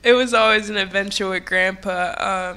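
ordinary talk

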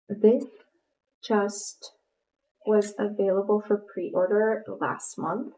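normal talking voice

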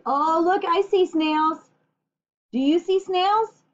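high bright delighted discovery; excited. Real, not acted. Excited at finding snails.

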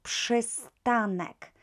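przystanek said slowly, syllable by syllable, psh at the start; slow Polish pronunciation. Shown as a demonstration. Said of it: "harder word".

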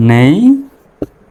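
নেইচার very slowly, "neeei... char"; syllable-by-syllable demo. Shown as a demonstration. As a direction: stretch the start of নেইচার high and rising, then pause before "char". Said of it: "সঠিক হচ্ছে নেইচার".

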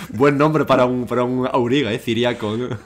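gleeful pitch for a racer name; excited. Real, not acted. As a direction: hail the name as a great find.

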